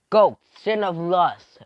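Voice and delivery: loud, high, drawn-out command; attack command. Acted. Fighter launching mind control, loud commanding call.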